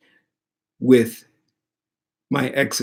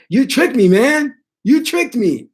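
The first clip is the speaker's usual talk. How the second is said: high, loud retold protest; playfully outraged, pitched way up; mock indignant accusation; 11 semitones higher, 4 dB louder than usual. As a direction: accuse the trickster, voice shooting up. Acts out accusing the spirit.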